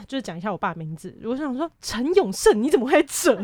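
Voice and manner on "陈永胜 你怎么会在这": calling out a name in loud, shocked disbelief; high, loud, stunned outburst at the father. Retold or imitated. (震惊地高声喊出名字)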